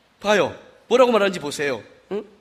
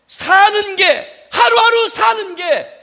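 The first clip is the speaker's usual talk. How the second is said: drawn-out lament; 7 semitones higher, 4 dB louder, much slower than usual. Retold or imitated. Retold lament of a church member.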